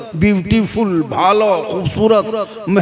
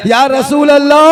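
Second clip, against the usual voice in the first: loud, raised invocation; calling out in prayer; 4 semitones higher, 7 dB louder than usual. Real, not acted. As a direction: call the Prophet aloud. Calls out in prayer. (ज़ोर से पुकारती दुआ)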